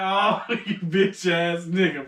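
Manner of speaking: slow, stretched-out insult through a laugh; laughing mock exasperation. Storyteller retelling his reply to his friend, laughing exasperation. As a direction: drag out the words in amused disgust.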